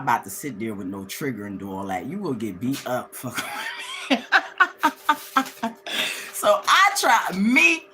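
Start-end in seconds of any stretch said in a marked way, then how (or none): 3.2-6.3 high cackling laughter; long burst of high giggles; real laugh. Real, not acted. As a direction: crack up at your own joke. Cracks up at own threat.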